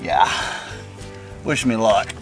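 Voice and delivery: ordinary talk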